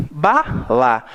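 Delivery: Bala split BA-la, weight on BA; stress on first syllable. Shown as a demonstration. Teacher gives a matching example, stressing BA. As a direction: separate Bala and lean on BA. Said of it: "a mesma coisa".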